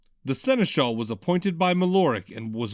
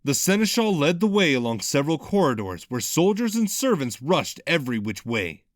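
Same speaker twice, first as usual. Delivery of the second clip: plain reading voice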